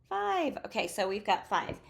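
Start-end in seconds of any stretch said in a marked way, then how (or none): 0.1-0.6 high-pitched model answer, falling; class calling answer. Acted. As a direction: call out the number like a pupil. Voicing the children's answer.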